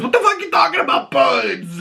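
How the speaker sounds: yelling in disbelief at the bird story; exasperated shout. Retold or imitated. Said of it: "he goes".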